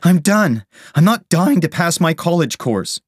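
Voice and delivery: high, loud cry of protest; fed-up cry. A narrator's reading. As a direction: cry out that you have had it. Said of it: "she cried".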